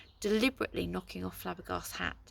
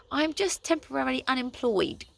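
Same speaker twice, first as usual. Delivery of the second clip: growled; 6 semitones higher, 5 dB louder than usual. A narrator's reading. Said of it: "growled Kaz".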